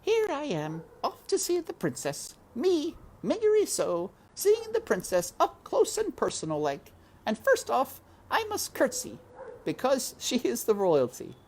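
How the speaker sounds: put-on very high, chattering country girl; Mig talking to herself. A narrator's reading. Mig talking to herself on the stairs, high and chatty. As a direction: prattle proudly to yourself. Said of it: "talking to herself as she went".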